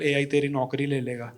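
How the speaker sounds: low, soft ominous voice; spooky doom-saying threat; mock ominous warning. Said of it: "मेरे को बहुत डराते हैं". (धीमी डरावनी चेतावनी)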